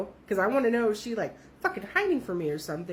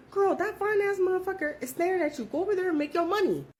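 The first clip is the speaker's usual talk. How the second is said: higher, hyped-up coworker pushing her friend; mild excited girlfriend voice; 9 semitones higher than usual. Retold or imitated. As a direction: hype her up like a bestie. Advising coworker, voiced high.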